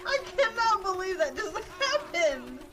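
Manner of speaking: high, loud laughing disbelief; disbelieving laughter. Real, not acted. Player laughing at a shocking game moment, loud and high. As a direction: laugh it out, can't believe it.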